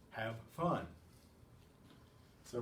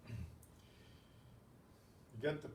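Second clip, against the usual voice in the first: usual speaking voice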